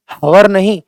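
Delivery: हवर with the H pronounced, then rejected; wrong, H sounded. Shown as a demonstration. Teacher showing students the mistake, H sounded in हवर. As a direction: say हवर with an audible H, dismissing it. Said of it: "एच का उच्चारण, pronunciation नहीं होता".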